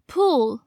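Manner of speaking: "pool" ending in a dark, blurred L. Shown as a demonstration. Teacher giving learners an example word, dark L in "pool". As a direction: say "pool" as a model, darkening the final L. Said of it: "L音要读成模糊舌边音".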